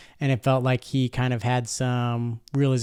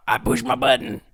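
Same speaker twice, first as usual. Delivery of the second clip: mock Southern accent; 2 semitones lower than usual. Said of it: "I made it Southern".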